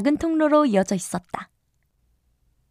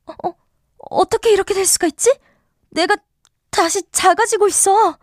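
high, fast, alarmed cry; panicked cry; 8 semitones higher, 4 dB louder than usual. Acted. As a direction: gasp it out in a panic. Alice panics as she shrinks.

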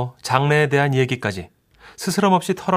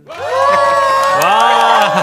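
excited cheer; 8 dB louder than usual. Acted. Juniors cheering arrival.

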